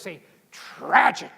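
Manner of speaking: milk the word "tragic" like a tragedian; big, slow theatrical "tragic"; dramatic drawn-out tragic. Shown as a demonstration. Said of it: "say it like this".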